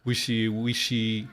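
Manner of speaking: usual speaking voice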